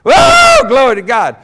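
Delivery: loud, high whoops of praise; re-enacted ecstatic church shout.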